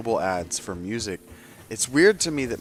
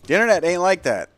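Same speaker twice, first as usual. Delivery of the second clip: emphatic; 9 semitones higher, 9 dB louder, faster than usual. Real, not acted. Emphatic punchline in rant.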